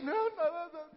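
high, frightened backtracking; scared child. Retold or imitated. Grumbling child caught by parent, scared retreat. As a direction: take it back like a scared child. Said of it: "porque les teníamos miedo".

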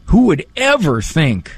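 ordinary talk

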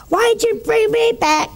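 high-pitched indignant mock yell; mad. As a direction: act out the outraged patient protesting. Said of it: "he was so mad".

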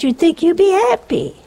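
ordinary delivery

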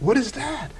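high, hushed retold astonishment; startled question. Recalling his shock at the blue walls, startled question. Retelling being startled by the wall.

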